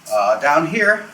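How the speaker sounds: normal talking voice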